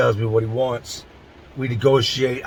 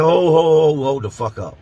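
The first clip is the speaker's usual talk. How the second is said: sudden louder cry of alarm, then dropping; shocked stop; 3 semitones higher, 4 dB louder than usual. Retold or imitated. Hearing his cellmate shot crack, alarmed. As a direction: slam the brakes on what he just heard.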